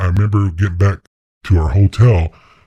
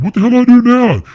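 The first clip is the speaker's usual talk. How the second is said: high, loud shout out a window; retold frantic yell; panicked yell; 6 dB louder, faster than usual. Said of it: "He's out the window".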